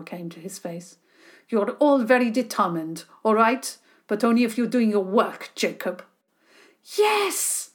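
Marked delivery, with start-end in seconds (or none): 1.5-6.0 louder, measured grown-up voice; relenting tutor. A narrator's reading. Tutor relenting to the eager children, measured. 7.0-7.7 very high, loud joyful yell; shout. A narrator's reading. Boy cheering when the tutor lets him play, loud and high. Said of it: "Jacob shouted".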